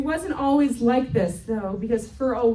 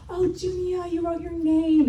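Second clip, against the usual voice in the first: high, sing-song mum voice; doting praise; 6 semitones higher than usual. Retold or imitated. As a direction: coo proudly to a small child. Mum praising her small daughter.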